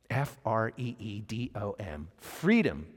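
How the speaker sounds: low, soft letter-by-letter spelling. Retold or imitated. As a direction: spell it quietly as if writing it down. Voices Theo writing second answer.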